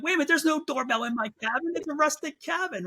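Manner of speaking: wait, this isn't right; baffled dreamer objecting to a doorbell; confused. Retold or imitated.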